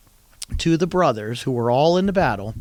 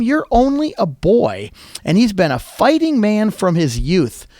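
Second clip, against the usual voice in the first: louder, higher scolding doubt; doubting king; 5 semitones higher, 6 dB louder than usual. Retold or imitated. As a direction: talk him down as a boy. Saul doubts David.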